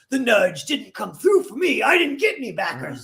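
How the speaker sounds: loud wrestler promo yell; pro wrestler shout. Retold or imitated. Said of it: "so he was like".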